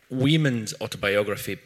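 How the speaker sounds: ordinary delivery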